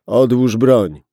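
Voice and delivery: loud command, stress on "broń"; ordered. Acted. Gunman ordering the policeman to drop the gun, loud command. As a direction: bark the order. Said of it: "rozkazał mężczyzna".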